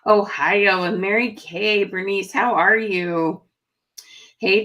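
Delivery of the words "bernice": ordinary talk, nothing marked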